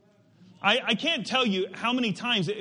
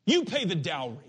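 demanding. Acted. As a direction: press the demand on a father, pausing between phrases. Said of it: "That's what he's demanding of his father".

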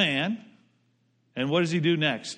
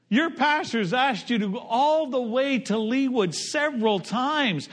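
ordinary talk, nothing marked

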